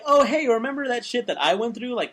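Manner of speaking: high, eager big-brother voice; hearty mentor. Retold or imitated. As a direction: cheerfully recall your own youth. Geordi voiced as cheerful mentor.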